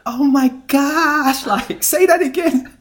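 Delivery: very high, loud, drawn-out mock gushing; going nuts. Hooters girl hearing British man say water, hammed-up high excitement. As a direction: imitate a waitress losing it over an accent. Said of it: "this girl went nuts".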